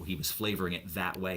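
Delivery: ordinary talk, nothing marked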